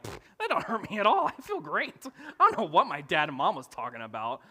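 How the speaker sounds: loud, high, exuberant dismissal; comic boastful disbelief; gleeful scoffing. Acted. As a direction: scoff at the very idea. Scoffing at parents' old saying.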